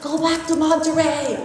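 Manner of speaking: high, acted-out panicked shout. Passenger shouts at pilot to turn back, shouting. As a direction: yell it up high, reliving the panic. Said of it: "I really shouted on the plane".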